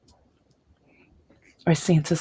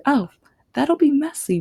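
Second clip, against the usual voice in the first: high, louder mock-concerned reply; mother's playful protest; 9 semitones higher, 5 dB louder than usual. Mother asked about frosting, high playful protest. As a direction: exclaim like an amused mom.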